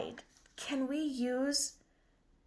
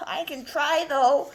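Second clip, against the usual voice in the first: loud, keen dog voice; dog puppet; 3 semitones higher, 10 dB louder than usual. Acted. Dog promising teacher to try, loud and keen. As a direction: offer it eagerly and loudly. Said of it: "This is my friend Spot".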